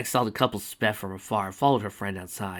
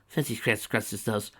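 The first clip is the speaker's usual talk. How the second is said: plain reading voice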